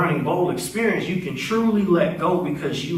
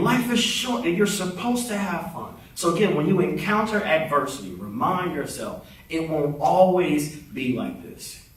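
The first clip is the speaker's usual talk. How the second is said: usual speaking voice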